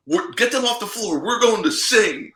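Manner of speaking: high-pitched mock player; imitating Brad Davison. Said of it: "he's literally just going".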